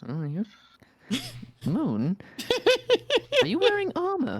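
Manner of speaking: high, startled in-character question; surprised. Acted. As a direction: jump up in pitch, caught off guard.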